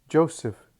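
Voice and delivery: sudden high cry of his name; shout. Acted. Mary shouting to Joseph in alarm, loud cry. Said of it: "shouts Mary".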